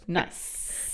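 leises, tiefes Spaß-Nice; softly hushed, not meant seriously; nicht ernst gemeintes Nice. Shown as a demonstration. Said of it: "ich mein das in dem Moment nie ernst". (leise und ironisch)

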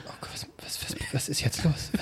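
hushed frightened whisper; frightened whisper to yourself as police knock; scared whisper. Retold or imitated. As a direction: whisper it like you're hiding behind the door. Scared hiding in room.

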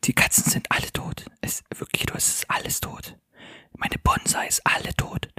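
hushed report of disaster; voiceless, secretive and grave; distressed whisper. Acted. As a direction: whisper the awful news from hiding. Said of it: "Deswegen leise reden".

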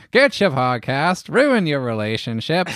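high, brassy newsie hawking the podcast; old-timey street corner voice. Acted. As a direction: sell it like a 1950s street crier. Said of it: "an old-timey, uh, street corner, uh, voice to sell the podcast".

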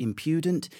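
impudent with the middle syllable leaned on; trial pronunciation. Shown as a demonstration. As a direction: try out impudent as im-PYOO-dent. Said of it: "how to pronounce it".

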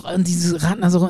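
usual speaking voice

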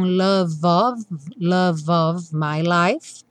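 love of run together into the O, slow; linked love of. Shown as a demonstration. Teacher models the linking, slowly. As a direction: glide love straight into of. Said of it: "não é pronunciado, já vai ligar direto no O".

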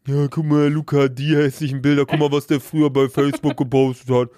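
low, snide mock of cohost; mocking imitation. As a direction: imitate her teasing voice. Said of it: "Mobbing-Folge".

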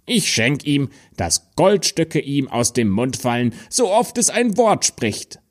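a small man announcing a wonderful gift; little dwarf rising to a high delight; kindly dwarf. Acted. Second dwarf grants a gift.

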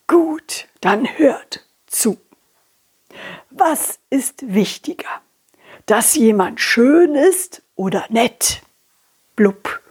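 put-on slow, whispered old fish posing a riddle; hushed old fish riddle. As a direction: lean in and whisper the question.

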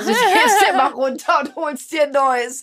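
can't get the line out without laughing. Real, not acted. Husband teasing wife about Nutella jars, laughing.

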